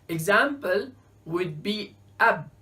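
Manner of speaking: usual speaking voice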